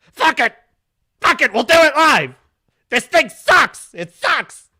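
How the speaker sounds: imitating a raging meltdown at full volume; O'Reilly rant. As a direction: explode like a TV host losing it.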